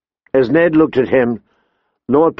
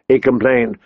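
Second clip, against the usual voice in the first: usual speaking voice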